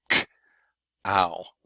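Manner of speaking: cow split into a bare k, then ow; k and ow as two separate sounds; onset and rime. Shown as a demonstration. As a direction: say k, pause, then ow. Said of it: "onset and rhyme".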